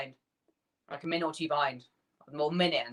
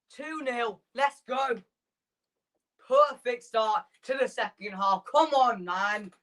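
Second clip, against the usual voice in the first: higher, still buzzing with joy; elated after the goal; 5 semitones higher, slower than usual. Real, not acted. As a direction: savour the lead, grinning.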